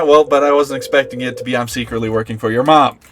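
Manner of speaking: ordinary delivery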